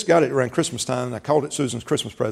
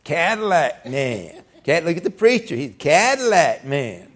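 slow, drawn-out Cadillac man; lightly mocking church folk; slower than usual. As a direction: tease the preacher lightly. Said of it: "people at church".

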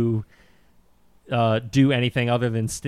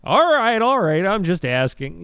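cameraman voice; 10 semitones higher than usual. Retold or imitated. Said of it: "off camera is just like".

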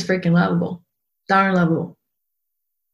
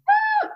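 squeaky high ah. Real, not acted. Sudden high exclamation.

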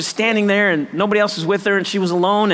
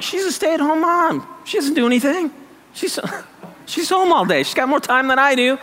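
mocking excuses; 8 semitones higher than usual. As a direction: whine it like a petty excuse, with a laugh. Mocking excuses about a neighbour.

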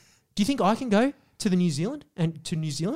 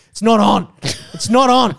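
loud, raised-pitch declaration; indignant outburst; 3 semitones higher, 7 dB louder than usual. Real, not acted. Fed-up podcaster rants about New Zealanders, indignant and loud. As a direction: lay down the law, loudly.

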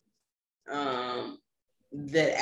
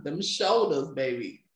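usual speaking voice

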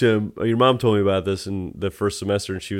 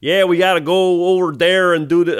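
higher-pitched, broad Chicago cop voice; Chicago accent; 6 semitones higher than usual. Retold or imitated. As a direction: imitate a Chicago cop's accent. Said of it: "what's a fucking Chicago accent?".

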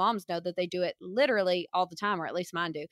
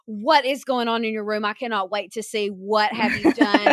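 hammed-up, sarcastic fascination; exaggerated excitement, building louder; mock eagerness; 4 dB louder than usual. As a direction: gush as if dying to see it. Sarcastic eagerness at being summoned.

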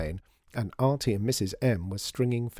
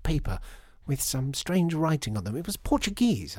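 high, swooping, delighted lady; gushing lady customer; 7 semitones higher than usual. Acted. Lady customer remembering childhood shops, gushing. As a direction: gush nostalgically with swoops up high. Voices Missus Mountjoy remembering sardines.

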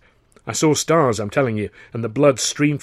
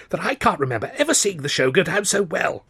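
high-pitched, gushing praise; excited, high-flying compliment; enthusiastic cry; 7 semitones higher than usual. A narrator's reading. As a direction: enthuse in a raised, eager voice. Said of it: "cried Buxton enthusiastically".

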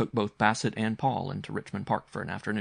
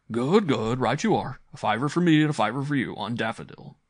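louder, lifted, emphatic agreement; hearty uncle; 4 semitones higher, 6 dB louder than usual. Acted. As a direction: give in to the boy heartily.

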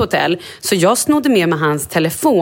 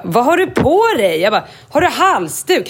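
omtänksam mamma; 6 semitones higher than usual. Retold or imitated. Oroligt förhör av Kalle.

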